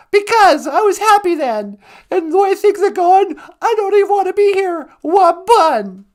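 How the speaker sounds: high, loud, whiny pretend lament; mock sad wail. Co-host pretends to fight with her partner on air, wailing high and loud. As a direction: wail it like a fake-hurt spouse. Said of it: "You don't sound that angry".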